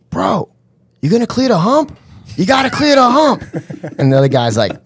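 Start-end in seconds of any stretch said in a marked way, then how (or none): 0.1-3.4 high-pitched mock bro arguing; arguing park guy. Guy at the jump to his buddy, arguing. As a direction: imitate a worked-up guy in a squabble. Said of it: "these guys are arguing".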